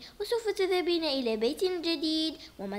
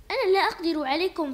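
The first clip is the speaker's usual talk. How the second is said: normal talking voice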